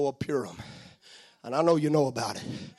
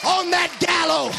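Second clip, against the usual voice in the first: preaching; 10 semitones higher than usual. Acted. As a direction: preach it out, full force. Preaching at full force, curse.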